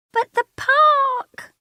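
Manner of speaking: put-on very high, loud child's protest; drawn-out squealing whine. Lara protesting to her father, squealing. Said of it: "Lara squeals".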